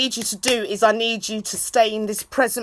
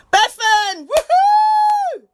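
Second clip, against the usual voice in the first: high, loud whooping cheer; excited cheer; 5 dB louder, much slower than usual. Real, not acted. Streamer whooping at her live audience.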